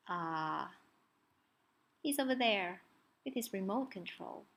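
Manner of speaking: ordinary delivery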